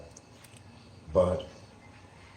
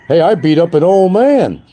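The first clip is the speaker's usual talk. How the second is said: mock tough guy boast; 10 semitones higher, 9 dB louder than usual. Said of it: "red badge of courage to these people".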